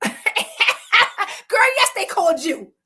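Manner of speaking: laughing high and loud through it; cracking up while telling it. Real, not acted. Laughing at Tasia's shocked reaction.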